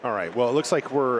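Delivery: usual speaking voice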